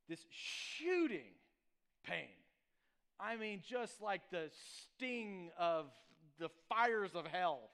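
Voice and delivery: drawn out, very slow, each word weighed; milk the pain for the audience; slow dramatic build. Retold or imitated. Dramatizes the ant sting.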